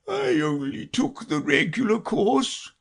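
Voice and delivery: slow, high, sighing regret; Mock Turtle. A narrator's reading. Mock Turtle admits his modest course, slow sighing voice. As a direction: sigh it out dolefully. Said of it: "said the Mock Turtle with a sigh".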